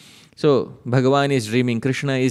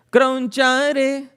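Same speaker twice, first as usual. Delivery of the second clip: calling out; 13 semitones higher, 4 dB louder than usual. Acted. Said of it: "calling Kartikeya in verse".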